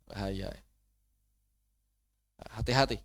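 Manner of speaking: ordinary delivery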